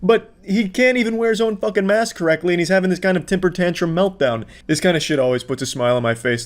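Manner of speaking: ordinary delivery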